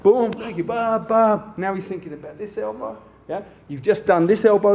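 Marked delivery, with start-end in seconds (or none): 0.0-1.5 mock thank you. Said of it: "You're like".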